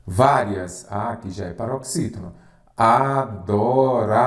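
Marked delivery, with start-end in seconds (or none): 2.8-4.2 "Adorável" drawn out slowly, syllable by syllable; split into syllables. Shown as a demonstration. Teacher showing a word's syllables to students, slowly split up. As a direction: stretch each syllable of "Adorável". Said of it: "separação em sílabas".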